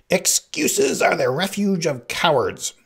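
high, loud, drawn-out rebuke; cutting in. A narrator's reading. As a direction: burst in with a slow, scornful shout. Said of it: "the Sicilian interrupted".

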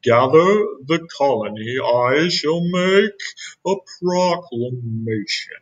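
put-on slow, grand old king voice; heroic regal voice. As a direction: proclaim like a noble elder. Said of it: "he said in a heroic, regal voice".

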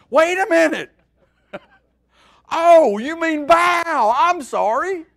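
high, loud comic protest; panicked mock yelling. Preacher imagining us facing the fire, hammed-up yelling. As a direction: yell it out as a hammed-up excuse. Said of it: "we'd have been yelling".

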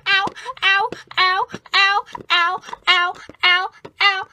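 loud hammed-up pained ows; mock limping ows. Bill pretending his leg hurts while leaving, hammed-up ows. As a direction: ham up the limp.

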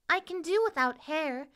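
high little doll voice, wistful; paper princess. A narrator's reading. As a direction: speak as a small homesick princess.

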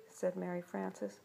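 usual speaking voice

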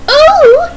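high, loud nervous "ooh". Acted. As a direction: let out a high startled hoot. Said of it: "said Daisy nervously".

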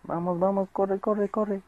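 high, loud, urgent urging; being chased. Real, not acted. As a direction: hurry yourself along in panic. Fleeing mob in game.

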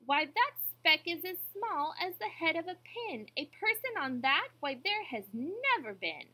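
high, loud, scornful objection; sour kangaroo. A narrator's reading. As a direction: scoff as a sour know-it-all. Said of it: "'Twas a sour kangaroo".

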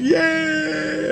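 long, high-pitched held cheer; acted jubilant "yay", stretched out. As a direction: cheer like a celebrating crowd. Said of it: "He's like".

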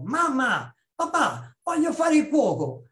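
put-on a thirteen-year-old bursting with his big news; lightly voiced eager boy telling his parents; excited kid. Boy announcing dream to parents.

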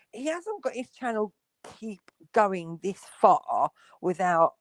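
ordinary talk, nothing marked

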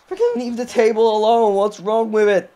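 high, loud, exasperated rant; angry complaint. Real, not acted. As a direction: scold the game in outrage. Raging at AI wrestlers.

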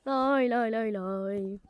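ordinary talk

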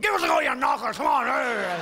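put-on rowdy drunk bellowing at women; drunken shout.

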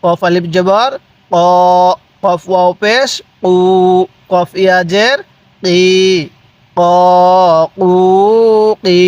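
spelling chant with "ক", "কু", "কি" held level; measured recitation, long vowel on "কু"; drawn-out vowels. Shown as a demonstration. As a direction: stretch each of "ক, কু, কি" separately. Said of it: "এরকমভাবে পড়বেন".

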